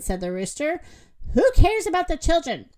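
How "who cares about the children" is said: high, loud, fast protest; indignant outburst. A narrator's reading. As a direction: snap back at the plea. Rooster rejects Moo's plea.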